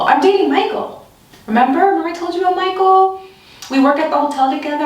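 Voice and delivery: high, fast flustered protest; high-pitched nervous rush; flustered insisting. Retold or imitated. Insisting she has a boyfriend.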